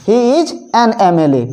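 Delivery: very slow, letters spaced out; slow classroom example; slow dictation. Shown as a demonstration. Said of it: "यानी कि N M L A".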